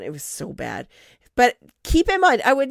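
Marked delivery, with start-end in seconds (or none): 0.0-0.9 It was so bad muttered under the breath; softer trailing echo of It was so bad; much softer, to himself. Real, not acted. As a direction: drop the repeat to a near murmur. Repeats his own judgement, trailing off.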